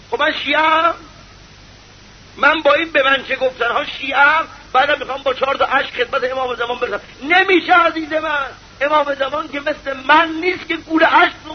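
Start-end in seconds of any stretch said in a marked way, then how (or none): none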